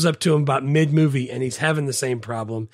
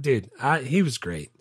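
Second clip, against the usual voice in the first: normal talking voice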